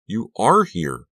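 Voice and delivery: high, slow amazed exclaim; stunned disbelief, drawn out. A narrator's reading. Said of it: "I cried".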